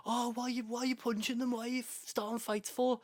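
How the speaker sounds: high-pitched mock telling-off; imitated nagging sister. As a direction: put on an indignant sister's voice, pitched well up. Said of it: "started giving my mate shit".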